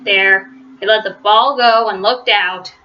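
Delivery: ordinary delivery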